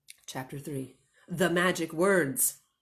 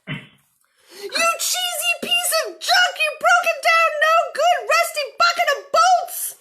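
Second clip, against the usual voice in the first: shouted insults; 8 dB louder than usual. Acted. Minnie yells at the broken tractor, high and loud. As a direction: belt it out like a furious farmer. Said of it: "the ones that the farmer shouts".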